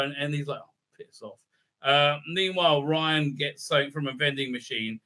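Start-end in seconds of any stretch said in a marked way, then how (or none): 0.6-1.4 a dismissive whispered piss off; a muttered, voiceless put-down; whispered brush-off. Acted. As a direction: breathe it out under your breath, annoyed. Grumpy character brushes off.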